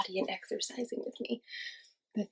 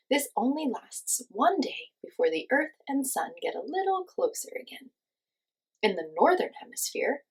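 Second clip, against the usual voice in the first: put-on louder, lively letter read for kids; reading a letter aloud; 2 semitones higher, 6 dB louder than usual. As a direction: read the message like a cheerful note to the class. Reads her winter letter aloud.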